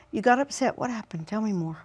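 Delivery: soft, gentle parent; soft mirroring. Shown as a demonstration. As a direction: draw him out softly. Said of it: "some mirroring".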